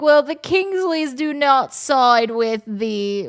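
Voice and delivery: high, loud, drawn-out mock declaration; haughty drama character. Retelling the K-dramas she is stuck on, hammed-up haughty. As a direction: proclaim it slowly like an overacted soap.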